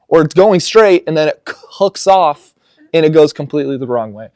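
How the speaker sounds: worked-up golfer griping about the club; frustrated golf rant. Retold or imitated. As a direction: vent like the ball won't obey you. Retelling golf frustration, building rant.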